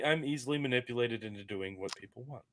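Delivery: ordinary delivery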